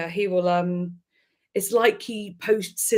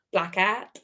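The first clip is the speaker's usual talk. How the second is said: mock Australian accent; slower than usual. Unable to stop the impression, a light Australian accent. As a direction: still doing the light Australian Blackout, giggling. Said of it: "and I'm still doing it".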